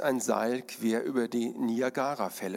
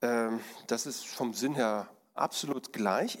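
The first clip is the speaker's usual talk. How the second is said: ordinary talk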